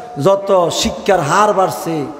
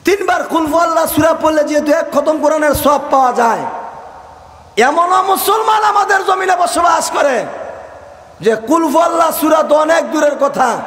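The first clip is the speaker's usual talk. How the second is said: fiery preaching; 10 semitones higher than usual. Acted. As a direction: rouse the crowd and demand they answer back. Preaching at full force.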